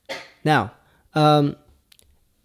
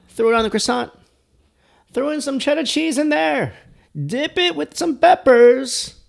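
high-pitched hyped squeal, building louder; over-the-top excitement; 13 semitones higher, 6 dB louder than usual. Pretend. Vlogger hyping the sandwich build, high excited yelling. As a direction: work yourself into a high, loud frenzy.

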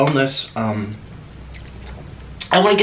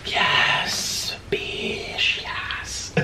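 hushed gleeful whisper; 8 dB quieter, much slower than usual. Real, not acted. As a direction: whisper your triumph to yourself. Savoring food, celebrating.

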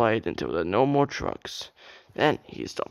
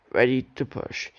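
ordinary delivery, nothing marked

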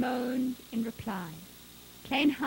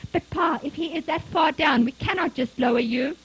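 loud, raised, worried objection; urgent protest; 5 semitones higher, 7 dB louder than usual. A narrator's reading. Son warning his father about the rope, urgent. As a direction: argue hard against a risky plan.

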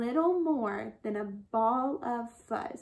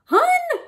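high, loud burst; sharp shock cutting into the story; sudden startled exclamation; 10 dB louder than usual. A narrator's reading. Narrator as lion suddenly appears, startled cry.

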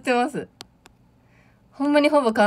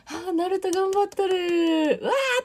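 high, drawn-out spectator cheer; long, high-pitched gush of excitement; mock excited cheering; 10 semitones higher, slower than usual. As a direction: imitate a fan thrilled for the home team. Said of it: "わーって湧いて".

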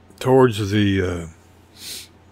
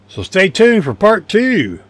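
higher, louder, slow and bright; upbeat sign-off; 10 semitones higher, 7 dB louder, slower than usual. Real, not acted. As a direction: announce the next part with gusto. Cheerful video sign-off.

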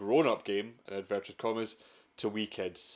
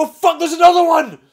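much higher and louder, screamed in alarm; panicked shriek; 18 dB louder than usual. Pretend. Explorer spotting another raptor, panicked shriek. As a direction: yelp like a second raptor just burst out.

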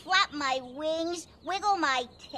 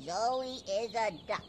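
lower, softer self-chant; repeating the mantra; 6 semitones lower, 5 dB quieter than usual. Shown as a demonstration. Zoe trying the mantra, low and soft. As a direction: quietly talk yourself into it. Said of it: "Okay, I'll try".

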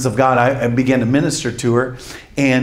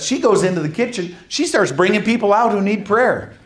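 usual speaking voice

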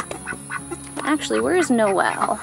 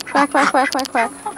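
playful duck imitation, a bit higher; quacking; 4 semitones higher than usual. Owner imitating her ducks quacking along, playful. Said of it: "quacking along".

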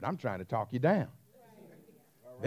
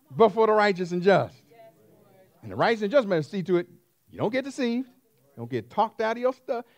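higher and louder, pounding each phrase; preaching at full force; 8 semitones higher, 8 dB louder than usual. Acted. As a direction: drive the sermon home to the crowd.

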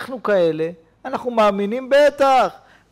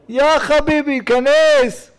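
calling out; 6 semitones higher, 4 dB louder, slower than usual. Retold or imitated. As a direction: call him in loudly, pleading buddy. Calling Noah into the ark. (קריאה רמה: יא חביבי, כנס!)

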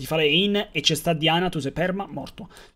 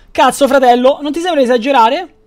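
loud protest; 7 semitones higher, 8 dB louder than usual. Real, not acted. Reacting loudly to a friend.

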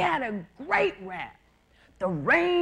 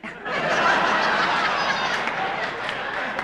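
big laugh; 10 semitones higher than usual. Real, not acted. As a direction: burst out laughing. Laughs at own joke.

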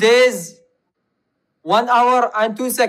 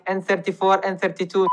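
normal talking voice

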